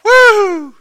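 loud, very high "woo!"; acted whoop. Preacher reliving his leap off the bars, high whoop. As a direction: let out a big swooping whoop.

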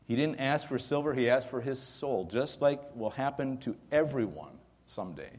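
ordinary delivery

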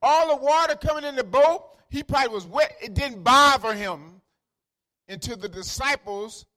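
high, loud sermon climax; full-force preaching. Acted. Preacher saying only the disciples' call woke Jesus, full-force preaching. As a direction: drive each phrase out hard to the congregation.

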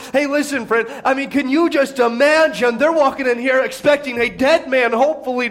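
normal talking voice

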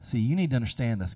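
ordinary delivery

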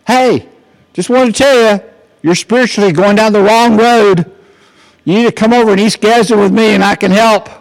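high, loud call up to someone; retold yell. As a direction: imitate a man yelling advice from the street. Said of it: "he yelled at me".

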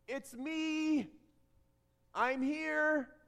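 calling out reassurance. Acted. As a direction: call gently across a distance, holding each phrase. Jesus calling out to the boat.

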